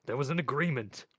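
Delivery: indignant appeal at full voice; loud protest. A narrator's reading. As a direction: insist on the deal, loudly. Albert protests, threatened by Mort.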